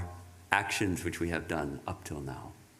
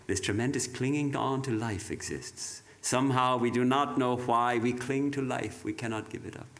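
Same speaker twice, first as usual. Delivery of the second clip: solemn reading; 4 semitones higher than usual. Acted. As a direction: proclaim each phrase with weight. Reads Vivekananda's words.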